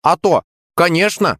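high, enthusiastic yes; delighted high-pitched agreement; eager exclamation. A narrator's reading. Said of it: "воскликнул я".

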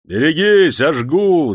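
loud, high executioner's shout; yelled warning. A narrator's reading. Executioner warns as he swings the whip, yelling. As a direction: yell before the lash falls. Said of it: "заорал кат".

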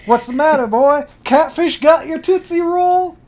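loud, high mocking sheriff; Sheriff Fat Lip. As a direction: bark it like a taunting sheriff. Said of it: "Sheriff says".

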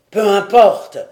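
a loud, savage bark; brutal retort. Acted. The fierce chief Karatete cuts off the captive lord, brutally loud. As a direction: slam it down like a hostile warrior chief. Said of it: "répondit brutalement Karatete".

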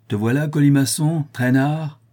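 loud, high jeering call; bandit's cry. A narrator's reading. The bandit hails the late storyteller, loud and jeering. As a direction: bark it across the room at the latecomer. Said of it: "s'écria le bandit".

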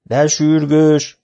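slow, high, loud call to the workers; shouting. Acted. Old teacher shouting at the workers, drawn-out loud call. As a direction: bellow it out, drawn out. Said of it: "kiabált a sárga ruhás munkások felé".

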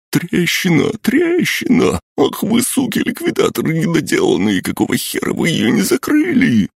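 very high, hysterical squealing; panicked squeal. A narrator's reading. Sidorovets spots the monster and blames the liquidators, panicked squeal. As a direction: squeal in outraged panic, far above your normal pitch. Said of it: "заметил одноглазое лихо и взвизгнул".

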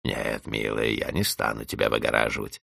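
low, soft icy sweetness; cold refusal. Acted. Housekeeper refusing Vanda, cold soft spite. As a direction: purr a cruel no.

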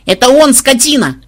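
high, loud accusing squeal; desperate shriek. A narrator's reading. Granddaughter denouncing the looter, shrieking. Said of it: "вдруг отчаянно заверещала внучка".

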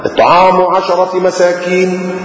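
slow, chanted recitation; Quran recitation. Acted. Preacher recites verse slowly to congregation. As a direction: intone the verse slowly and reverently.

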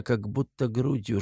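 ordinary delivery

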